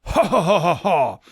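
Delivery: high, hammed-up ho ho ho of an old wizard; mock old-man cackle. A narrator's reading. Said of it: "Dumbledore started to cockle".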